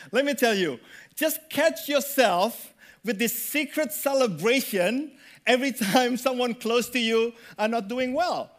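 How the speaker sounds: ordinary talk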